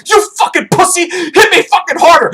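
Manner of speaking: high, loud, strained yell; wounded soldier taunting his buddy, shouting; mock pained shout. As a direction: dare him to hit harder. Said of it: "He's like".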